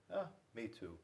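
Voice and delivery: ordinary delivery, nothing marked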